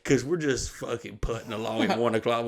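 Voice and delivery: normal talking voice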